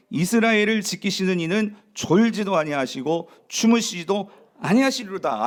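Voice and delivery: loud, slow, deliberate recitation; scripture reading. Acted. Preacher leading the congregation in reading a psalm together, proclaiming. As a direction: declaim the psalm for all to follow.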